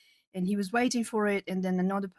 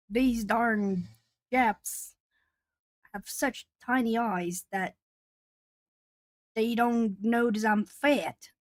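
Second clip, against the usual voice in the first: a mock Texan twang, slower and louder; imitating Texan accent; 3 semitones higher, 4 dB louder, much slower than usual. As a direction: put on a man's Texan twang, drawn out. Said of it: "the way he said it sounded so Texan".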